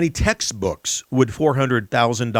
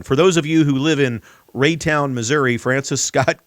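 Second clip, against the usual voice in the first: ordinary talk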